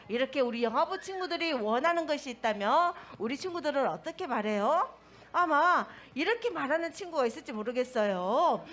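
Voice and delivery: ordinary talk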